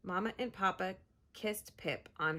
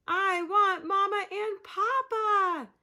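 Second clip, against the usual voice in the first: high, loud, slow wail; 10 semitones higher, 8 dB louder than usual. Acted. Lost penguin chick wailing for her parents, high and loud. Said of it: "she wailed".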